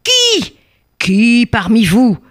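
high, drawn-out outraged demand; cry of pain and rage. Acted. Stung king demanding the culprit, cry of pain and rage. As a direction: cry it out as a wounded, raging king. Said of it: "le roi cria de douleur et de rage".

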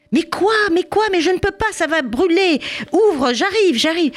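torn between stove and door; flustered mother. Acted. Mother at the stove answering the children, flustered high voice.

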